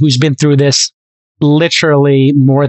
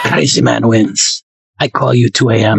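mock Japanese accent; 4 semitones lower than usual. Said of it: "I'm doing a Masa impersonation".

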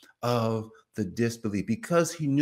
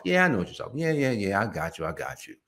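brushing someone off; 3 semitones lower, faster than usual. Acted. Listener who already knows the topic, brushing the talker off. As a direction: wave them away with quick agreement. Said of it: "you kinda just brush them off".

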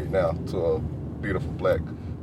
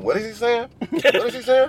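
high mock bewilderment, louder on the repeat; baffled friend; 13 semitones higher, 3 dB louder than usual. Friend can't follow dad's accent, high baffled imitation. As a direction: imitate a confused friend, pitched way up.

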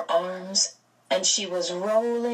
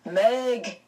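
call; 4 semitones higher than usual. A narrator's reading. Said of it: "came a call".

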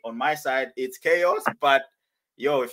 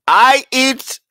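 loud, slow put-on Nigerian accent; 4 semitones higher, 11 dB louder, slower than usual. Host performing for the players, loud Nigerian accent. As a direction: proclaim it in a mock Nigerian accent. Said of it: "you have to have a Nigerian accent".

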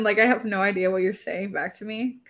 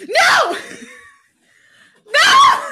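much higher, loud cry of No; despairing shout; 11 dB louder than usual. Real, not acted. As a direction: howl it in despair at the dropout. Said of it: "Genuinely going insane".

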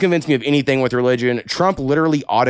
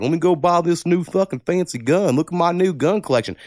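lightly mocking gun show-off, pitched higher; mock gun enthusiast; 6 semitones higher than usual. Podcaster imitating people who buy fancy guns, mildly mocking. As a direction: put on a slightly higher voice for a boastful gun buyer. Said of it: "I'm all these people that's like".

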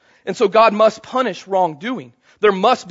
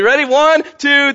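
ordinary talk

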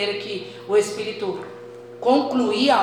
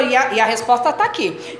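usual speaking voice

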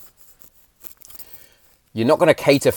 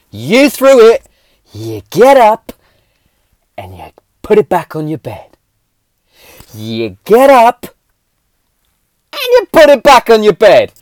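loud firm scolding; stern scolding; 10 semitones higher, 9 dB louder than usual. Retold or imitated. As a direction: lay down the law to a sulky kid. Scolding the child.

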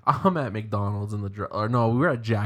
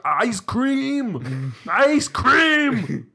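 rising, louder high yell; goofy yell; 12 semitones higher, 5 dB louder, much slower than usual. Retold or imitated. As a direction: yell it across the class. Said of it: "I'm like".

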